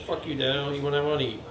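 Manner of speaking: lightly voiced sassy dog, quick and soft; voicing the dog. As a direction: put cheeky words in the dog's mouth.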